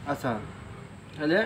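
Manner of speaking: ordinary talk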